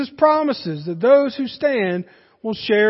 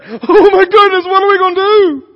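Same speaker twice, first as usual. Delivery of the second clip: loud, high, wailing helpless cry; hammed-up panicking fireman; mock panic; 9 semitones higher, 11 dB louder than usual. Fireman arriving at a burning house, mock panicked wail. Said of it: "a fireman w-, pulling up to a, a house on fire and saying".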